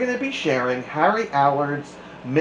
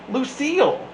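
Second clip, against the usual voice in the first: plain reading voice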